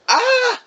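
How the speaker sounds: a kid's startled 'Ah!', acted out; mock scream. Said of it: "I was like".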